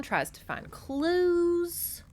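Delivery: slow, high teasing storytelling; drawn-out narration. Acted. Vlogger teasing the mystery to viewers, slow and high. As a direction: stretch out the suspense.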